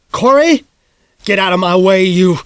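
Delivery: loud high urgent yell; father yelling at son. A narrator's reading. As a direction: yell at the boy to move. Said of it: "Dad yelled".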